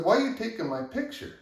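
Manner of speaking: high, loud accusing question; angry demand. Retold or imitated. Worker confronting the superintendent about the photo, high angry demand.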